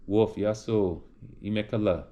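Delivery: ordinary delivery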